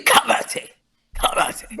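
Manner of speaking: unvoiced, breathy karate like a martial-arts film; Bruce Lee karate. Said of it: "what you're doing is Bruce Lee".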